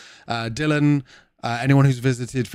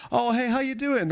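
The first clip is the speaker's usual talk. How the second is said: breezy Brit greeting; 12 semitones higher than usual. Retold or imitated. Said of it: "a Brit says".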